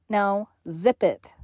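normal talking voice

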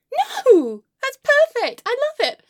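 high, over-sweet politeness; fake smiling approval. Client to hairdresser while screaming inside, fake smiling approval. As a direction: gush with a forced smile. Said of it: "outside, I'm smiling".

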